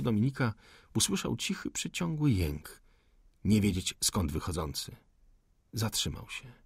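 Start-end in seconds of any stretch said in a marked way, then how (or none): none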